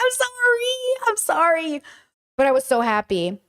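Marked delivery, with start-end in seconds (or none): 0.0-1.1 very high, drawn-out sorry; high re-enacted sorry; squealed apology. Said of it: "and I was like". 1.1-1.8 moderately high apology, coming down; re-enacted sorry, easing down; repeated apology. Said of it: "and I was like".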